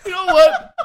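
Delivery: high, helpless laughing and crying; laughing through tears. Real, not acted. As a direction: crack up until the voice breaks into tears. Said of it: "I'm crying".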